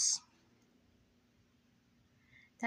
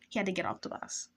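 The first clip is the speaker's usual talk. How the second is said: softer, muted afterthought; quiet repeat; 6 dB quieter, faster than usual. Real, not acted. Teller repeating the letdown to herself, quietly. Repeat of line as low aside.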